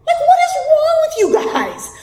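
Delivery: high, disbelieving cry; exasperated disbelief; incredulous. Real, not acted. Incredulous outburst.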